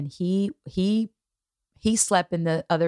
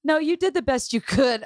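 higher put-on voice, laughing through tears; imitating the daughter; 8 semitones higher than usual. Daughter reassuring her mother, higher and laughing. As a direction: play the daughter, half laughing.

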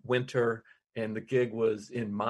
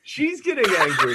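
laughing; 6 semitones higher, 5 dB louder than usual. Real, not acted. Echoes angry drunk woman.

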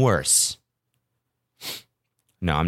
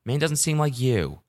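gentle disappointment; 6 semitones higher than usual. Retold or imitated. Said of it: "I was kinda like".

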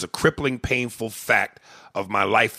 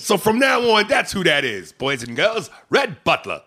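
1940s radio announcer; 8 semitones higher, 4 dB louder than usual. Acted. Radio persona names himself.

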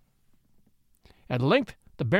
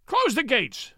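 high, barked order; furious shout; 7 semitones higher, slower than usual. A narrator's reading. Maddened Baron ordering the gates shut, shouting furiously. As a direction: shout it in rage. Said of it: "shouted the maddened Baron".

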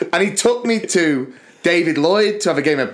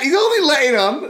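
high-pitched, incredulous plea; mock protest; 11 semitones higher than usual. Pupil sticking up for little kid, high mock protest. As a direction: protest in a raised squeak. Retold protest on the kid's behalf.

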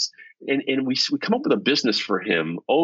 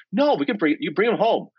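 wife insisting; 6 semitones higher than usual. Retold or imitated. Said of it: "She goes".

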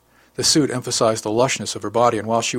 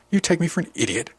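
spat; 7 semitones higher than usual. A narrator's reading. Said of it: "she spat".

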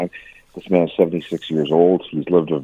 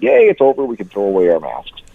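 mock celebration; 3 dB louder than usual. Americans suddenly deciding the pandemic is over, mock celebration. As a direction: imitate a crowd's sudden jubilation.

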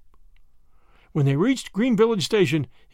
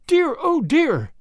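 high, drawn-out cry of dismay; wild exclamation; 11 semitones higher, 4 dB louder, slower than usual. A narrator's reading. Grandma finding her train ticket gone, exclaiming wildly. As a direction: wail it in distress, pitched well up. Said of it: "exclaimed Grandma wildly".

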